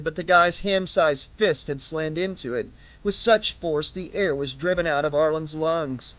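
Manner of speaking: usual speaking voice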